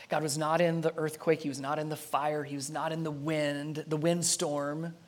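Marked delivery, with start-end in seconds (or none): none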